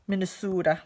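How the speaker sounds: Minnesota with a long "oo", Minne-SOO-da; playful Minnesota accent on the long O. Retold or imitated. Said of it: "they always have that amazing accent".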